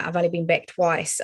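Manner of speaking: ordinary talk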